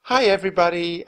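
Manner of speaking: friendly hello to the patients; smiling warmly. A narrator's reading. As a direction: greet them with a warm smile. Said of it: "smiling warmly".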